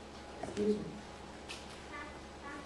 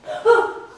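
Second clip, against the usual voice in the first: gasp; 11 semitones higher, 7 dB louder than usual. A narrator's reading. Sudden grab in the well.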